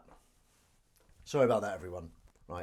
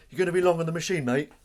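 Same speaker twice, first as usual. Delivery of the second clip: loud, raised-pitch challenge; acted confrontation; 8 semitones higher, 12 dB louder, faster than usual. Man at the gym pestering someone on a machine, loud challenge. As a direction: call it out high and loud.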